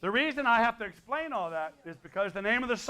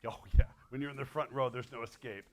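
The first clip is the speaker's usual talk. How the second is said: ordinary delivery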